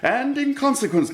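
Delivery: high, mocking lilt; gloating. Fosco gloating to Marian, voice pitched high. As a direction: toy with her, pitched high.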